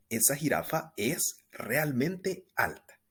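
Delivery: ordinary delivery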